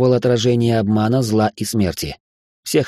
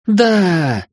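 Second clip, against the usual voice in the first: triumphant cry; 9 semitones higher, 4 dB louder than usual. Acted. Girl triumphs in battle.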